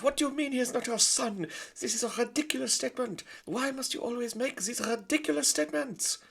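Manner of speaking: put-on very high, loud French-accented mother; haughty French lady; French mother mouse. Antoinette answering her husband, high French haughty.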